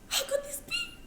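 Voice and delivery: very high, tearful, rising shriek; shrieking disbelief. Acted. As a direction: shriek as the bug returns. Seeing cockroach alive.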